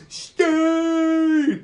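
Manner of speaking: imitated yell, high and loud; one long, drawn-out mock battle holler; Tony. Hero charging in to tackle the villain, imitated yell. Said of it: "Tony comes in yelling".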